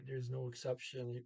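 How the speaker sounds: normal talking voice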